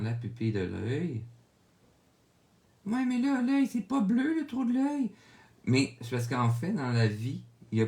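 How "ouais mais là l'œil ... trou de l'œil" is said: high squeaky voice of a doubting viewer; high put-on voice of a viewer questioning the blue; mock viewer objection. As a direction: play a skeptical student asking, pitched way up.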